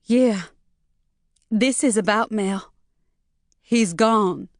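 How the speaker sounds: a touch of held-back sobbing; mildly tearful, forcing words out; choking on a sob. A narrator's reading. Maureen telling Jay her husband left, choked up. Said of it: "Maureen managed, choking on a sob".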